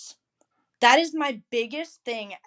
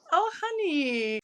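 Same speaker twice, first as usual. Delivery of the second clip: mock pity; 7 semitones higher, slower than usual.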